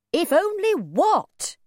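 high, drawn-out demanding question; impatient demand. A narrator's reading. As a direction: slowly press him for the rest. Mother demands, slow, high.

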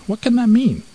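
high, loud, bewildered question; baffled. A narrator's reading. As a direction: ask it in high-pitched puzzlement. Mouse baffled by the name.

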